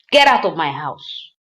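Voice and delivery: loud mock order to leave; imitating angry dismissal. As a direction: throw him out, retold.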